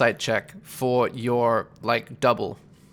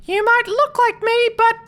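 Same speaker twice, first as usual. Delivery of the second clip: squeaky, very high and louder threat, last words slow; Flea's voice; 7 dB louder than usual. Acted. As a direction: squeal the threat like a tiny manic creature. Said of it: "and Flea says".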